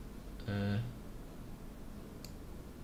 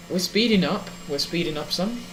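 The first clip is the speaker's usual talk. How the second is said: thrilled, rising high and loud; can't contain the excitement at takeoff; excited; 7 semitones higher, 4 dB louder than usual. Real, not acted. First test flight takes off.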